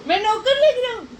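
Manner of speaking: high, loud mock whine, easing toward the end; imitating spouse's complaint. Wife re-enacting husband's servant complaint for viewers, exaggerated whiny imitation. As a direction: mimic an indignant grumble, starting high. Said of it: "ऐसे कर रहे हैं guys".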